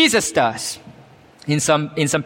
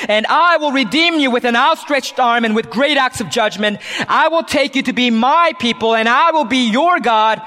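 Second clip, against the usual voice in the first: preaching at full force; 6 semitones higher than usual. Acted. Preacher proclaiming the Lord's promise to Israel, full force. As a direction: ring it out like a herald of God's promise.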